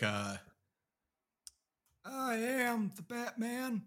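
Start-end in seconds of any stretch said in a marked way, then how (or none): 2.1-3.8 high, slow, drawn-out politician impression; Bill Clinton. Shown as a demonstration. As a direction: do a bad ex-president impression, pitched up and stretched. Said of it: "try a Bill Clinton right now".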